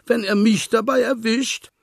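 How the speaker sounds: worried the fox will get it caught; scared piglet. A narrator's reading. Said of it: "protestierte Schweinchen erschrocken".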